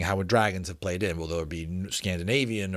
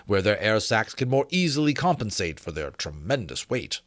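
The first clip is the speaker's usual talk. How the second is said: put-on measured, formal reading of an old treatise; formal reading aloud; 5 semitones higher, 4 dB louder than usual. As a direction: read it like a learned gentleman's letter. Reads textbook excerpt.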